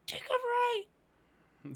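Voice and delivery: high, squeaky, drawn-out bird voice; bird whispering advice. Acted. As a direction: slowly squeak out a secret tip as a bird. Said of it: "whisper to you".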